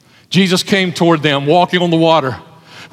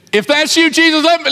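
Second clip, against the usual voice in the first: eager Peter calling; 10 semitones higher than usual. Acted. Peter voiced calling to Jesus.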